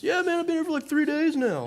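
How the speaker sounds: high, loud goofy dude voice; mock the clueless rafting guide; careless guide. Preacher mocks the rafting guide who'd worked three days, high and goofy.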